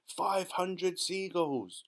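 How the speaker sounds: high, slow called-out count; triumphant shout. Acted. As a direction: proclaim the number. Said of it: "James shouted".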